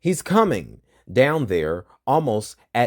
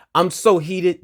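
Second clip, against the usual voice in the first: higher and louder with real anger; heated; 9 semitones higher, 6 dB louder, slower than usual. Real, not acted. Telling viewers she's heated, real angry outburst. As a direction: let the anger burst out. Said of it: "I'm just heated".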